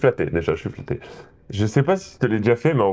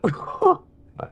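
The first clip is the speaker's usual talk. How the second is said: laughing. Real, not acted. Laughs at his robot voice.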